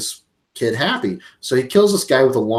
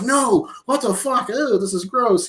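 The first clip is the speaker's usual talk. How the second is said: high-pitched recoiling disgust; mock horrified; 11 semitones higher than usual. Retelling a kid finding the skinned head, mock horrified. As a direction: squeal it like a grossed-out kid.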